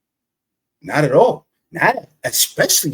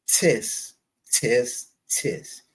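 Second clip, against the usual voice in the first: tongue clicks; 5 semitones lower, 6 dB quieter, much slower than usual. Real, not acted. Host clicking tongue in disapproval.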